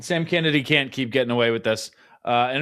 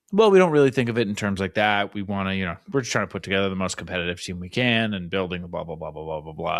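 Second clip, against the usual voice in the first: a hint of a vague corporate non-answer; mock front-office dodge, low and trailing off; wishy-washy executive; 7 semitones lower than usual. Mocking the exec's vague non-answer, lightly voiced. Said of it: "given a wishy-washy".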